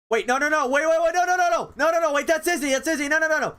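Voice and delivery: high, loud urgent stopping; frantic stop-it rush; panicked no. Real, not acted. Streamer stopping copyrighted audio, loud panicked cries.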